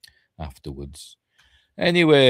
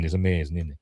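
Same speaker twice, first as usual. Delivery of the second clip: ordinary delivery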